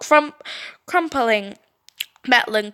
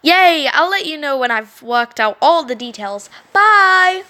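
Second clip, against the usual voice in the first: fake cheer; 4 semitones higher, 5 dB louder than usual. Brittany leaving cheerfully.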